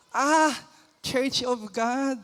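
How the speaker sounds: ordinary talk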